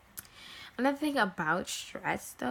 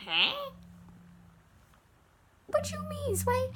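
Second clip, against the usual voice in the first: confused onlookers. Retold or imitated. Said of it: "people will look at you like".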